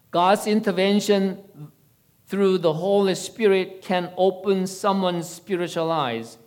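measured, projected reading of a testimony; sermon read-aloud. A narrator's reading. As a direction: read it out to a congregation from a script. Resumes reading the prepared testimony.

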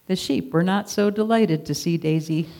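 plain reading voice

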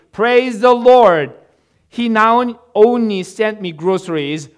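loud, high cry of praise; jubilant shout. A narrator's reading. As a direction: shout out your triumphant thanks. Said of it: "and shouted".

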